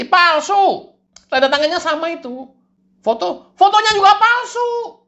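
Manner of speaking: high, loud cartoon devil insisting; shrill it up and declare it fake.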